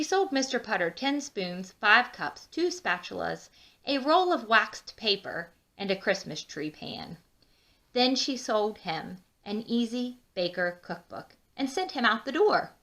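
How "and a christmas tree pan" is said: plain reading voice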